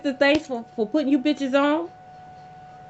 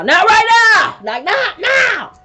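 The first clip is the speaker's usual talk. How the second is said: much higher and louder, nearly shrieking; mock shout; 9 semitones higher, 9 dB louder, slower than usual. Herself retelling snapping at God, a loud high shout. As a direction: snap back at God in a full panic. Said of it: "Then it's like".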